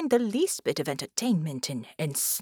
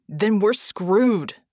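loud, drawn-out slow shout; frustrated shout; 8 dB louder, much slower than usual. A narrator's reading. Red shouts back at Vicky, exasperated. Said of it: "Red shouted".

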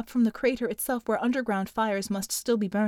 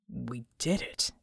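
a hint of stunned disbelief, low, soft and slow; disbelieving; 10 semitones lower, 7 dB quieter, slower than usual. A narrator's reading. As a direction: say it like you can't believe it. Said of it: "sounding as if he didn't ... believe it".

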